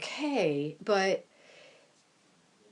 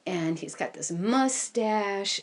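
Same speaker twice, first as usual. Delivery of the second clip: ordinary delivery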